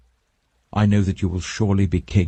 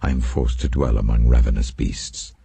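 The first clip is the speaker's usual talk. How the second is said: psalm reading; 5 semitones lower than usual. Acted. Reader reciting Psalm fifty-seven to listeners, low solemn recital. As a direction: lay each line down like a prayer.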